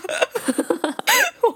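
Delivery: a high, falling burst of laughter. Real, not acted. Laughing while telling the grandma story, a high falling laugh. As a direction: let a high laugh spill out and fall away.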